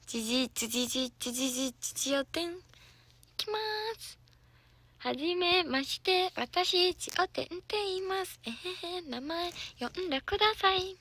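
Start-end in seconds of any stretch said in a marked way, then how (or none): 1.8-11.0 cute idol intro. Pretend. Idol introducing herself to fans in a sweet higher voice. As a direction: introduce yourself sugary for the fans. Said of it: "idol self-introduction for fans".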